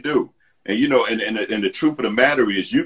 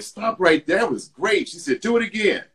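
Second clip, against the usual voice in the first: lightly voiced casting director, higher pitch; a hint of an enthusiastic casting lady, pitched up; 11 semitones higher than usual. Casting lady's voice retold.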